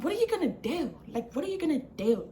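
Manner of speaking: high, mocking New York-ish voice; mimicked New York accent. Storyteller demonstrates her rival's accent, mock New York challenge. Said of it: "a, a accent".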